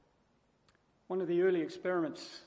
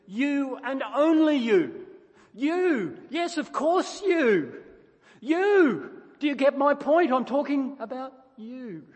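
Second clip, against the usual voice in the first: "You" hammered higher and much louder, slow; emphatic you; 6 semitones higher, 11 dB louder, slower than usual. Shown as a demonstration. Preacher acting out the emphatic Greek pronoun, pointed and loud. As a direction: jab every "you" at the listener. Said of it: "It's emphatic".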